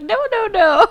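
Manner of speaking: a lightly mocking squeaky no; mimicking friend's refusal. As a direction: friend quoting her co-host's protest, high mock voice. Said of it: "you literally were like".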